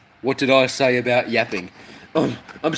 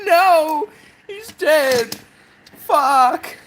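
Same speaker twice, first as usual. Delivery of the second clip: mock panic; 14 semitones higher, slower than usual. Mock panic over death.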